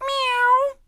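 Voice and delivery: very high meow like a kitty; cat meow. A narrator's reading. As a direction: mew up high like a little cat. The cat wakes the old man.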